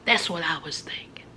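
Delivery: low hushed confession; whisper. A narrator's reading. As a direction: whisper the admission. Hushed aside in story.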